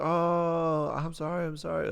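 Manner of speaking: high-pitched mock apology; imitated apologetic girl. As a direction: put on a girl's high voice, apologizing. Voices girl apologizing after punch.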